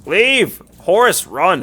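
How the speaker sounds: urgent yell to the crew; shouting. Real, not acted. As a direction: shout the order out. Crew in danger, yelling orders.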